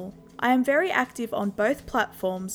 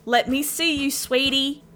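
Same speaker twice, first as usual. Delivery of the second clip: shout; 5 semitones higher, 3 dB louder than usual. A narrator's reading. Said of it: "He started to shout".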